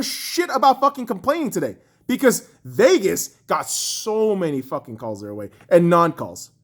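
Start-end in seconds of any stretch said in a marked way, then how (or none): none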